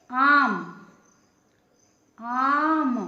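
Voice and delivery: आम said loudly, with a wide-open, long आ. Shown as a demonstration. Teacher gives students the example word आम, loud and open. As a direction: stretch the आ in आम, mouth wide. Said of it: "पूरा मुंह खोला गया".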